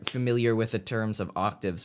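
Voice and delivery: normal talking voice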